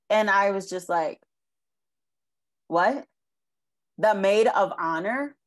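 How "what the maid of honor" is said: incredulous. Retold or imitated. Stunned by sister's request.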